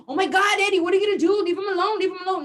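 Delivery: high, loud frantic plea; panicked pleading. Retold or imitated. Mom in the car begging Eddie, high and loud. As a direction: beg him to stop, voice up high. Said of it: "and I'm like".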